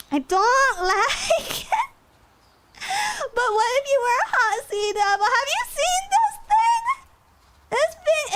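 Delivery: high, squealing mock complaint; hammed-up whine. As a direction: whine it out with rising squeaky outrage. Exaggerated whining rant at drawing.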